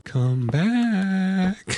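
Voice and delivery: high, sing-song ghostly call; imitating a floaty ghost lady calling out; sing-songy ghost voice. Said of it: "she's doing that little sing-songy voice".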